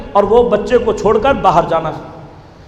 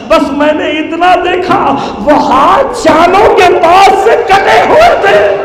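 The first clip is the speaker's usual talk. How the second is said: slow, high, loud cry breaking out; wailing climax shout; 6 semitones higher, 6 dB louder than usual. Acted. Preacher reveals the severed hands to mourners, slow loud wail.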